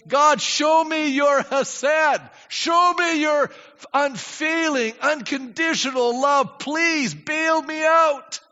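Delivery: slow, high, loud begging to God; pleading prayer. Retold or imitated. A desperate believer begging God.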